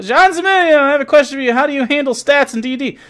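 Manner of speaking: high-pitched caricature of a questioner; mock eager questioner. Voices a viewer calling out question.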